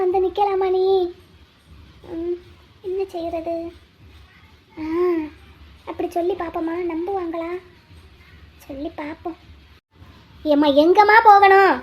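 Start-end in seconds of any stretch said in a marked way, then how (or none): 2.1-9.4 very soft, trailing to almost nothing; hushed self-talk. Acted. Passenger privately working out a cover story, hushed. Secretly plots a story to herself. 10.5-11.7 much louder, insistent question; loud impatient demand. Acted. Auto driver pressing his silent passenger for directions, loud and impatient.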